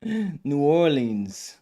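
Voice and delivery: a gasp, then the name drawn out slowly; gasping surprise. Real, not acted. Host reacts to a guest's trip with a gasp and a slow drawn-out name. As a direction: caught off guard, stretch the city's name.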